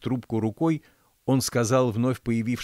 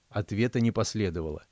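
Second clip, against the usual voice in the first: ordinary delivery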